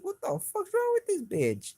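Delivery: very high, exasperated retold outburst; high indignant disbelief; pissed off. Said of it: "that shit pissed me off".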